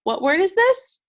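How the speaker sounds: high, loud questioning prompt; eager teacher prompt to the class; bright prompt. Shown as a demonstration. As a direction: pitch it up to invite an answer.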